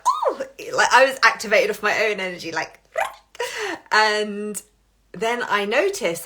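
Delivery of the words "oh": surprised oh. Retold or imitated. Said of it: "I was like".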